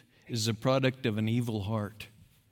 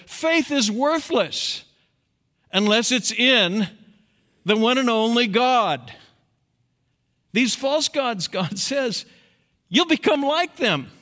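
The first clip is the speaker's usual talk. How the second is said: full-force sermon; preaching; 12 semitones higher, 8 dB louder than usual. Acted. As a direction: thunder it from the pulpit.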